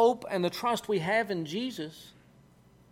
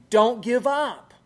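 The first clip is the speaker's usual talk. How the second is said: slow, loud, higher plea; urgent encouragement; 4 semitones higher, 7 dB louder, slower than usual. Acted. Jesus urging a struggling believer on, loud and slow. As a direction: push each word out slowly and forcefully. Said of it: "the one of Jesus that says".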